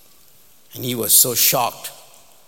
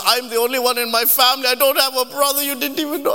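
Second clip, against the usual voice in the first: high, loud, rushed protest; shocked, upset date; 12 semitones higher, 5 dB louder, faster than usual. Retold or imitated. Date asked about a brother she lacks, shocked. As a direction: wail it indignantly in mock upset. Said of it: "And she's shocked".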